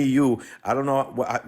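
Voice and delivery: ordinary talk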